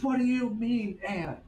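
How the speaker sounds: mock the outraged teacher laying into you; hammed-up high scold. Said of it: "She goes".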